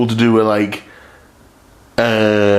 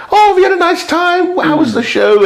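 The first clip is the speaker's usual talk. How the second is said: much higher, sweet mock wife voice; imitating his wife. His wife on the phone asking about the show, high mock voice. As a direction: pitch right up to play the wife. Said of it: "she's like".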